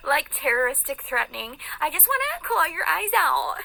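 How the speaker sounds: very high, exaggerated mocking squeal; mockingly scared. Woman mocking people who are scared of her, high mock alarm. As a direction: pitch it way up, ending in a high fake laugh.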